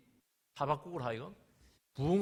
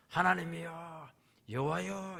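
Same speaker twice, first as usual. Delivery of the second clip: 하나님이여 drawn out slowly, then 여호와여; slow wondering cry to God; slow exclamation of awe; 4 dB louder, slower than usual. Shown as a demonstration. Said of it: "이렇게 이제 감탄을 합니다". (천천히 감탄하며)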